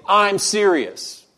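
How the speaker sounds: slow, high-pitched insistence; dead serious. Retold or imitated. R C Sproul to an awkwardly laughing audience, dead serious. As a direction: insist on it, stretching the words out. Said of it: "he couldn't be more serious".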